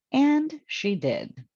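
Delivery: plain reading voice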